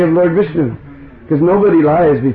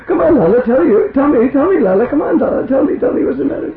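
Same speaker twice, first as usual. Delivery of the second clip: tender coaxing; 9 semitones higher than usual. Acted. Mother coaxing her upset son.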